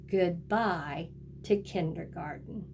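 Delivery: ordinary talk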